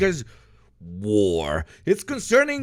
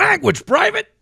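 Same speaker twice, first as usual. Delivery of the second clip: higher, loud scolding sergeant; mock drill sergeant; 13 semitones higher, 5 dB louder than usual. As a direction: snap at a private. Officer scolds in a bit.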